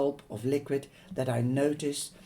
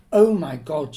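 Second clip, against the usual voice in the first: alarmed exclamation; 3 semitones higher, 12 dB louder than usual. Acted. Mother alarmed at flask.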